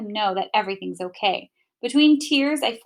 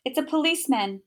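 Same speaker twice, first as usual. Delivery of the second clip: ordinary delivery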